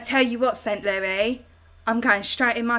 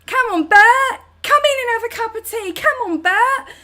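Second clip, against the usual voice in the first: much higher, louder shout for Bert; calling out to granddad; 12 semitones higher, 10 dB louder than usual. Pretend. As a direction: yell across the garden for granddad Bert. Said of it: "it sounds like I'm being like".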